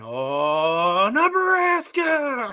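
high, drawn-out football holler; mock yell. Football player yelling in the center's face, a huge drawn-out high mock yell. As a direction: bellow it long and loud up at the top of your pitch. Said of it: "Just yell in the face of the center".

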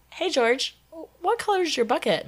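high-pitched, casual call to a ghost; imitated chummy ghost question; friendly coaxing question. Retells her friendly question to ghost.

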